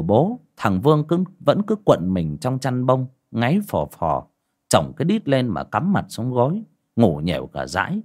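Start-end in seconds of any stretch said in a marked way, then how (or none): none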